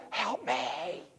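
a hushed, terrified plea; whisper. A narrator's reading. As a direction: breathe it out so the lion won't hear. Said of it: "he whispered".

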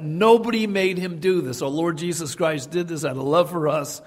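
normal talking voice

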